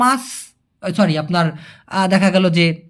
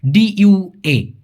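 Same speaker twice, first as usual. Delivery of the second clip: slow spelling; much slower than usual. Shown as a demonstration. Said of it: "ডি যেটা".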